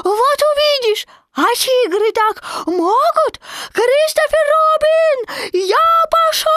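put-on very high, loud tiny-child squeak; ecstatic squeal. A narrator's reading. Baby Roo calling Tigger to jump, ecstatic squealing. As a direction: squeal with wild delight, far above normal pitch. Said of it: "пришёл в безумный восторг и запищал".

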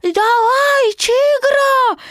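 put-on very high, loud child's call; squeaky shout. A narrator's reading. Baby Roo urging Tigger to jump, high squeaky shout. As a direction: squeal it out as an eager cheer. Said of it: "крикнул он".

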